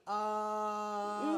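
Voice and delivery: long, hesitant filler; acted bafflement. Host recalling first hearing the news, baffled. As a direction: drag it out, mock-baffled. Retells own baffled reaction.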